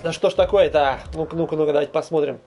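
usual speaking voice

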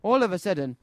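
usual speaking voice

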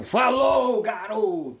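high, loud shouted send-off; shouted goodbye. Real, not acted. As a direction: yell the farewell with a big pitch leap. Repeats farewell, even bigger.

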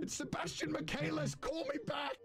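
high-pitched shout of the name. Acted. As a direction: screech it out, half laughing. Laughing at own voicemail.

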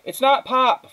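high, loud shouted correction; shouting back. A narrator's reading. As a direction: holler the answer back to mother. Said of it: "Flora shouted back".

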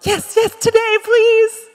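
high, squealing mock-eager plea; mock eagerness. Preacher jokingly begging to be corrected, mock eagerness. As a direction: beg for it with exaggerated keenness.

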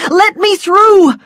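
high, loud, drawn-out crowd shout. A narrator's reading. Someone in the crowd fights for the lists, shouting. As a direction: shout to get past people. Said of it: "there were shouts of".

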